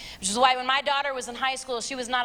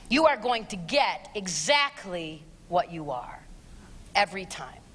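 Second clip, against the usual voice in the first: ordinary talk, nothing marked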